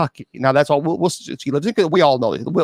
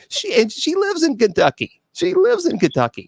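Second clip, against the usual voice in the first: high-pitched disbelief; 12 semitones higher than usual. Real, not acted. Host is amazed she missed her home state's capital, high disbelief. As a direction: voice climbing higher on the repeat.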